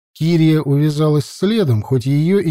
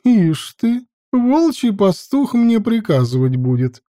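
higher, scornful scoff; 8 semitones higher than usual. A narrator's reading. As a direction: sneer at him lightly. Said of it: "хмыкнула добродея".

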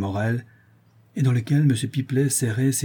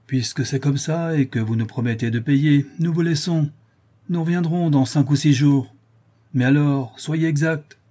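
higher, louder public announcement; raised voice; 5 semitones higher, 4 dB louder than usual. A narrator's reading. Bailiff tells the artisan they are leaving, raised voice. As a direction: project it openly, pitched up. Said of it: "reprit Bourdin à voix haute".

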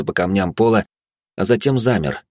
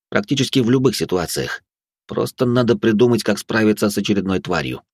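ordinary delivery, nothing marked

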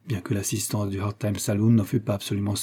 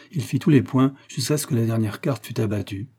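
ordinary delivery

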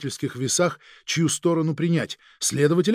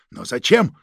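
higher, louder, sceptical challenge; distrustful question; 5 semitones higher, 6 dB louder than usual. A narrator's reading. Chief doubts the agent's explanation, distrustful and pointed. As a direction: question it as if you don't believe it. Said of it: "недоверчиво спросил начальник сыскной".

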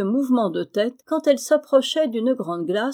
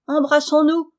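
higher, louder squawking parrot; 6 semitones higher, 6 dB louder than usual. Acted. Parrot quoted by Amy.

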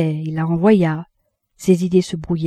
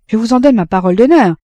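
quick, loud, higher-pitched vow; emphatic assurance; 4 semitones higher, 8 dB louder, faster than usual. Acted. Café keeper assures the doubting Frédéric, quick and emphatic. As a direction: swear to it briskly.

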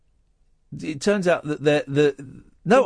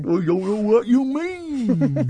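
mocking echo; 8 semitones higher, much slower than usual. Mocking cohost's line back.

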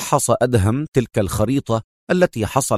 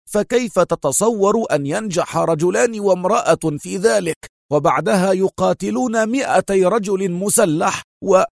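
much higher, agitated outburst; nervous angry shout; 8 semitones higher than usual. A narrator's reading. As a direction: pile the objections on in a flustered rage. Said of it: "صاح في عصبية".

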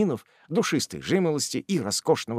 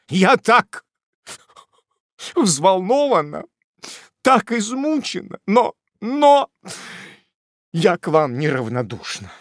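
much higher, louder, halting and breathless; agitated confession; 9 semitones higher, 6 dB louder than usual. Acted. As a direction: falter out the confession.

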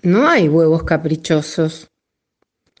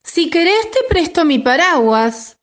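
little chick voice; 11 semitones higher than usual. A narrator's reading. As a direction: answer gently as a tiny chick. Chick offers umbrella.

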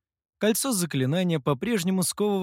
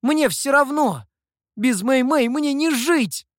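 loud, high-pitched cry; anguished, high, defiant shout; desperate outburst; 10 semitones higher, 5 dB louder than usual. A narrator's reading. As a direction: cry out with reckless passion. Desperate youth defies death threat.

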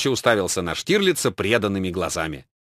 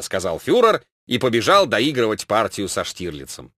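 usual speaking voice

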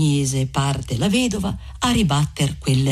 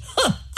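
gleeful high cackle; 3 dB quieter than usual. Acted. Curate gloats over the plague.

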